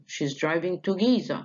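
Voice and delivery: Giza carries the fall. Shown as a demonstration. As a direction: drop down on Giza. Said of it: "جت على جيزة". (نغمة هابطة على Giza)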